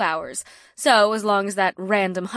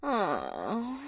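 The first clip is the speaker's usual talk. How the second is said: disappointed; 7 dB quieter than usual. Acted. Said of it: "with a disappointed tone".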